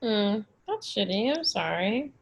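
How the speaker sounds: usual speaking voice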